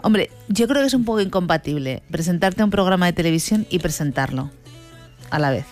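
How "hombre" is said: usual speaking voice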